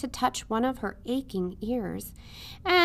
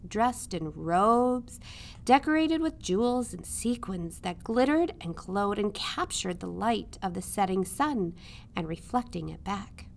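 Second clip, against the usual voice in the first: ordinary delivery, nothing marked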